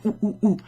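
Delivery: punchy separate "oo" sounds; oo sound demo. Shown as a demonstration. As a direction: demonstrate the round vowel in "oo, oo, oo". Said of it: "like an oo sound".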